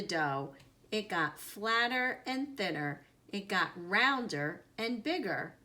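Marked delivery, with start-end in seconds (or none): none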